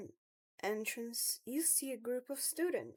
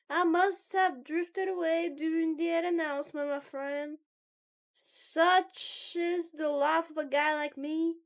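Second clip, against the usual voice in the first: loud, grand club joker; Jerry; 6 semitones higher, 10 dB louder than usual. Acted. Loud guy excusing himself to a friend, grandly projected. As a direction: project it like a showy proclamation.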